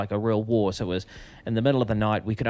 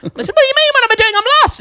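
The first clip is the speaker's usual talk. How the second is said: very high, loud, panicky squeal; panicked protest; 8 dB louder, faster than usual. Retold or imitated. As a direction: squeak it out, lost and frightened. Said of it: "I said".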